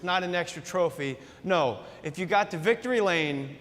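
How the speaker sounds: ordinary talk, nothing marked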